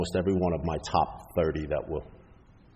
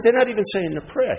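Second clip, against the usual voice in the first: high, louder mock outrage; indignant complaint; 12 semitones higher, 5 dB louder than usual. Newcomer complaining to sponsor at meeting, high-pitched indignation. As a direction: protest like an aggrieved newcomer.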